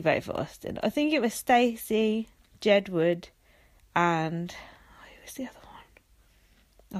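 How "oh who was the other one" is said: very quiet, whispered aside; muttering to self. Real, not acted. Vlogger trying to recall a voter, whispering to self. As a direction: whisper it while searching your memory. Trying to remember who voted.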